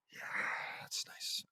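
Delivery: soft, voiceless whisper; whispered reply. Acted. Reader voicing Lisa's reply to the rescuer, whispered. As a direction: barely voice it, all breath.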